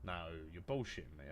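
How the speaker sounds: usual speaking voice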